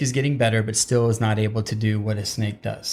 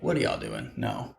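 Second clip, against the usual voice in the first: incredulous fan; 14 semitones lower, 8 dB quieter than usual. Retold or imitated. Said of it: "would just be like".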